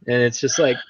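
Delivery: ordinary talk, nothing marked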